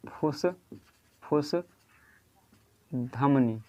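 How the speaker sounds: फुसफुस stretched very slowly, each syllable long; very slow dictation of फुसफुस; drawn out. Shown as a demonstration. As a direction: drag out फुसफुस for students to copy. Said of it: "याद रखिएगा".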